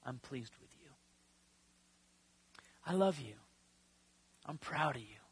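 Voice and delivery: soft, hushed and low; tender voice of God. Acted. God the Father reassuring his Son, soft and tender. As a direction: whisper it like a gentle reassurance.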